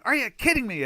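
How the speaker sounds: high, loud disbelieving shout; loud exasperated yell. Retold or imitated. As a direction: shout it as loud as you can at the chart. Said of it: "as loud as I can say it".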